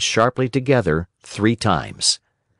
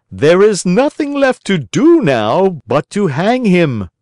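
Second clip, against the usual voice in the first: a higher-pitched, louder assassin voice; grim assassin, first voice; 8 semitones higher, 4 dB louder than usual. A narrator's reading. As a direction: play the first killer announcing the hanging. Assassin proposes hanging the puppet.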